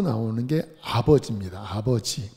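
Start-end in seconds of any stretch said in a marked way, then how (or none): none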